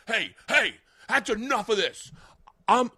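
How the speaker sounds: high, loud mock scold of the comic; hammed-up boyfriend defending his girlfriend; mock angry boyfriend. Comic imitating the boyfriend telling him off, mock anger.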